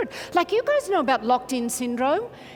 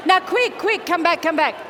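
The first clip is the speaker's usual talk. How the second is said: calling back; 3 semitones higher, 5 dB louder than usual. Real, not acted. Calling the noisy audience back.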